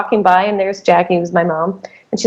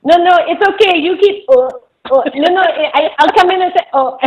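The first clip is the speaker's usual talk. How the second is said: put-on high-pitched French-accented doctor, reassuring through retches; 11 semitones higher than usual. French doctor dry heaving while offering to help, imitated French accent. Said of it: "she had a French accent".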